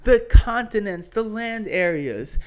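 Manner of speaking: usual speaking voice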